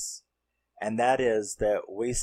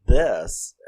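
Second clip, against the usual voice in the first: freaked-out friend; 3 semitones higher than usual. Retold or imitated. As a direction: play the shocked friend who can't believe what she sees. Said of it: "so they're freaked out".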